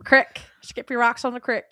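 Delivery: "crick" said with a short i, kʰɹɪk; crick for creek. Shown as a demonstration. Correcting the co-host, Southern style: "crick" with a short i. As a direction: rhyme "crick" with stick, not creek.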